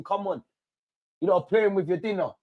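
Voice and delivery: ordinary delivery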